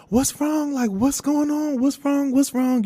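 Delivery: high-pitched fretting mom impression; worried mommy mode. Mom to crying daughter in the car, worried mommy mode. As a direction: pile up the questions in a high motherly voice. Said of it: "she really hopped into mommy mode".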